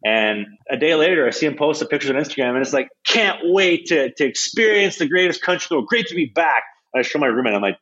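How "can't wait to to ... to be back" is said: mock gushing. Player reads out his teammate's Instagram post, mock gushing. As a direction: gush it like a show-off's caption, mockingly.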